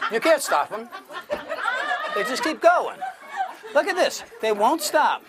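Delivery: high, loud amazed crowing; gleeful stage patter shouted over laughter; excited showman. Real, not acted. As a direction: play up the runaway trick to the crowd. Hypnotist crowing over laughing crowd.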